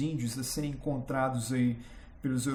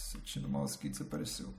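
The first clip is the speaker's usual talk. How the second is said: muttered aside; 13 semitones lower, 9 dB quieter, faster than usual. Real, not acted. Aside while hunting the mouse pointer.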